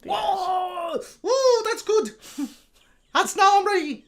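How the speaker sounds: very high, strained Irish rock star; mock pained falsetto. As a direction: squeak it out in a put-on Irish accent. [name] acts being kicked before singing.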